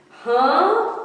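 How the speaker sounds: high, loud surprised huh; sudden surprise; startled huh. Acted. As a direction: caught off guard. Fish surprised.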